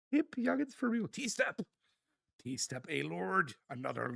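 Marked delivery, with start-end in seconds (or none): 0.1-1.6 laughing through the words. Real, not acted. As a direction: giggle while reading it out. Repeating chat message, then laughing.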